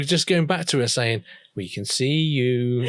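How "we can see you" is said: creepy voice. Retold or imitated. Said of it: "they are proper creepy".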